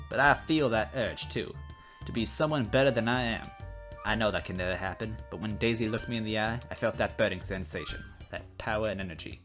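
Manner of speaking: plain reading voice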